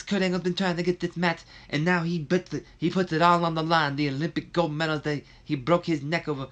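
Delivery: high, loud, excitable put-on commentator voice; Dusty Rhodes. Commentator hyping Kurt Angle's title chance, hammed-up impression. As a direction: stammer and gush with over-the-top amazement. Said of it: "asking for a Dusty Rhodes impersonation".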